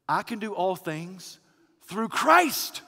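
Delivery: pause, then lift "through Christ"; stress on THROUGH CHRIST. Shown as a demonstration. Preacher showing the operative phrase, strong stress on "through Christ". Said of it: "The operative phrase of full force".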